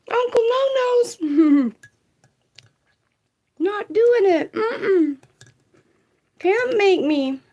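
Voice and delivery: high, crying mock tantrum; whiny child voice. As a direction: whine like a stubborn kid refusing. Mock whiny refusal, laughing.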